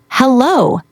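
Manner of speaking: a light, cheerful hello; happy hello. Shown as a demonstration. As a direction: greet someone with real delight. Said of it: "say it happily".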